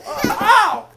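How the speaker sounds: high, loud ow through a laugh; real pained yelp. Real, not acted. Dad falls while filming his toddler, pained laughing yelp. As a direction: yelp as you hit the floor.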